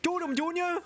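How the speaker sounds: very high-pitched, hammed-up Creole accent. Shown as a demonstration. Trying out a Creole accent on talk of alligator and jambalaya, hammed-up. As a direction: whoop it up in an exaggerated Louisiana Creole voice. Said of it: "there's, like, a Creole accent as well".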